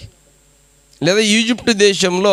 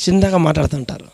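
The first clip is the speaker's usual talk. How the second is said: usual speaking voice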